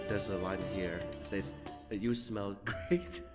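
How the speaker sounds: usual speaking voice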